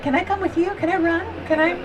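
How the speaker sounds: high, pleading puppy begging to tag along; a dog asking to come along, lightly voiced high pleading; eager dog voice. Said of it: "he's like".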